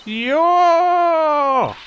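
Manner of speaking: shout. Acted. Calling out to stranger.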